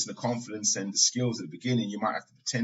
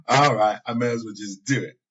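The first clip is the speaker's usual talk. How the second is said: resigned sigh, laughing; 5 dB louder than usual. Retold or imitated. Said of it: "she'll be like".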